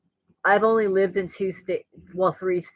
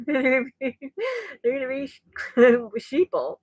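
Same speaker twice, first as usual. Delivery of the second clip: laughing; 5 semitones higher than usual. Real, not acted. Laughing at own joke.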